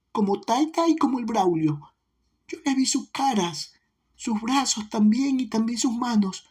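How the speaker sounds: put-on small child holding back tears as she answers; tearful little girl. A narrator's reading. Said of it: "tragando sus lágrimas".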